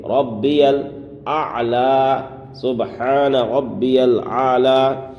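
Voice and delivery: slow ربی الاعلی with a doubled ba; سبحان ربی الاعلی with a clear ha and a firm ba; correct recitation. Shown as a demonstration. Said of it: "یہاں پر تشدید ہے با میں".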